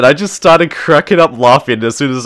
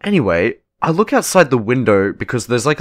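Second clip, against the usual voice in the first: ordinary talk, nothing marked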